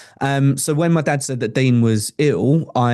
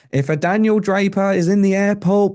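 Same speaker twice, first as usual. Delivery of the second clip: higher, tannoy-style paging voice; airport announcement; 8 semitones higher than usual. Retold or imitated. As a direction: page him over the airport speakers, higher pitched. Airport tannoy paging him by name.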